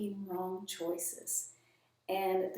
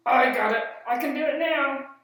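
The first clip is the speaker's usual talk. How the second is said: higher, louder puppet, triumphant at the end; proud puppet; 4 semitones higher, 9 dB louder than usual. Acted. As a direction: count proudly and get it wrong.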